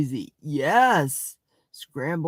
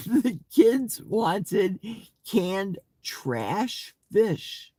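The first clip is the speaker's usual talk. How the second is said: drawn out; 4 semitones higher, much slower than usual. Acted. Reading chat comment with relish.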